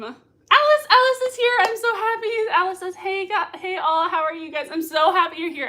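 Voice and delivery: high, loud gushing welcome; excited greeting. Real, not acted. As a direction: squeal hello to a friend. Greets arriving viewer Alice.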